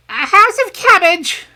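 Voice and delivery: Whitey answering from the trough; greedy piglet. A narrator's reading. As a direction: blurt it out between bites. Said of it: "replied Whitey, with a mouthful".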